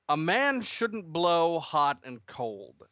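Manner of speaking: grave pronouncement. Acted. Father pronounces a moral to his son, slow and grave. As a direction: intone it slowly like a sage. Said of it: "a pronouncement for the ages by his tone".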